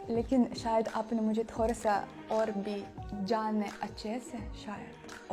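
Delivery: usual speaking voice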